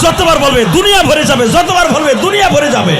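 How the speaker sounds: repeated line climbing to a high shout; preacher's rising crescendo; building preaching refrain. Real, not acted. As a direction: build each repeat into full-force preaching.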